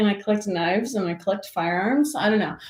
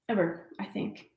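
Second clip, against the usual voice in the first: hushed aside; 8 dB quieter than usual. Real, not acted. Hushed afterthought.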